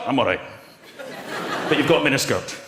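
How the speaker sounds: a hint of a fed-up Scotsman in a skirt; Scott's voice. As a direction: play Scott, low and unimpressed. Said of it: "Modelling it here is Scott".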